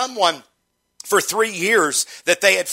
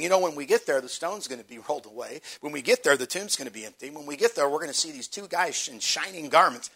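softer, lower retold chatter; understated mock dialogue, dropping at phrase ends; imagined conversation; 3 semitones lower, 8 dB quieter than usual. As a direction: toss off the imagined conversation quietly. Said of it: "I can hear the conversation".

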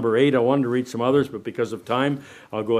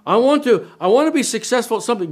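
mock eager voice; 9 semitones higher, 3 dB louder than usual. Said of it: "they're gonna say".

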